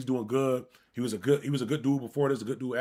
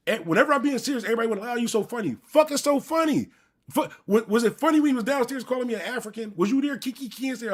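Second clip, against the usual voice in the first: indignant rant; 7 semitones higher than usual. Real, not acted. Angry rant at laughing cousin.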